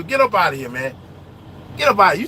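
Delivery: normal talking voice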